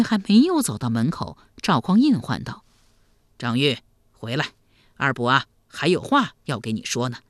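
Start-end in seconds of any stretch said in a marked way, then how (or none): 3.4-7.2 low, calm elder calling back; deep avuncular voice; kindly emperor uncle. Acted. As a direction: summon him gently.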